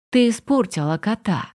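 usual speaking voice

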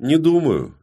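usual speaking voice

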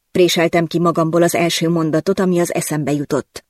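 plain reading voice

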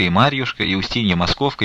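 ordinary delivery

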